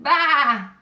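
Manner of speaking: loud bleating baa; loud sheep baa. A narrator's reading. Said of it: "Sheep said".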